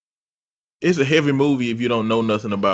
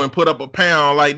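ordinary delivery

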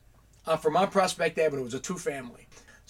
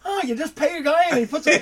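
put-on kid's naive wonder; 12 semitones higher, 7 dB louder than usual. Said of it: "And I went".

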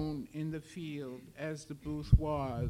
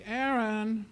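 raised-voice call of a name; louder, drawn-out summons; calling loudly; 3 semitones higher, 5 dB louder than usual. A narrator's reading. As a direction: call out to someone in the house. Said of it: "and called loudly".

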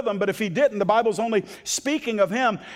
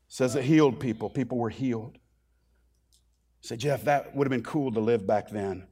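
much lower, softer sermon voice; hushed, sober preaching; 10 semitones lower, 5 dB quieter than usual. Real, not acted. Preacher confiding to the congregation about belief, hushed and sober. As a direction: drop right down and speak gravely, with pauses. Drops into low, subdued preaching.

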